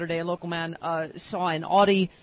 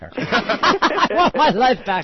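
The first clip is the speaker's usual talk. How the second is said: laughing; slower than usual. Real, not acted. Laughing mock complaint.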